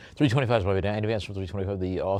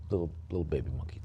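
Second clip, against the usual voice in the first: ordinary talk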